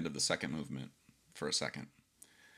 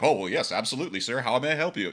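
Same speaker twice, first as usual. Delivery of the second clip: mock servile eagerness; 5 semitones higher, 5 dB louder than usual. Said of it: "you're like".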